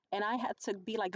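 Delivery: ordinary talk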